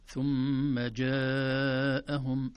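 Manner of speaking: ثم جاءهم chanted slowly with four-beat madd; slow tajweed chant; Quranic recitation. Shown as a demonstration. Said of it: "التنبيه الأول في حرف الجيم".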